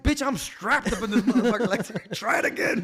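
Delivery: high, loud swaggering threat; tough guy threat. Retold or imitated. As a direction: dare him to try.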